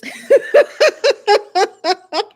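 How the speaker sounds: high, loud laugh at her own joke. Real, not acted. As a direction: crack up out loud.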